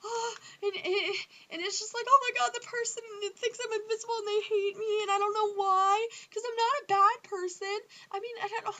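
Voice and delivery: high, loud, whiny near-crying rant; mock despairing wail. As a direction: wail it like a hurt teen, hammed up. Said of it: "and you're like".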